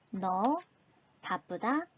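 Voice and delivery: usual speaking voice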